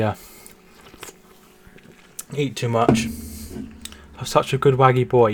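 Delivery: ordinary delivery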